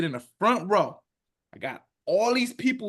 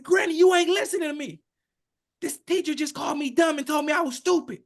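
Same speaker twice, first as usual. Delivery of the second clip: yelling; 9 semitones higher than usual. Retold or imitated. Grandson on the phone yelling to granny about the teacher. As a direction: yell it at granny, desperate to be heard. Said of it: "I start yelling again".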